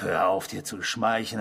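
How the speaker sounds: normal talking voice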